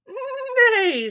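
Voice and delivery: long, high, loud whinny; horse neigh. A narrator's reading. Horse greets Blue, high loud neigh. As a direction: neigh out loud, falling away. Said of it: "said a horse".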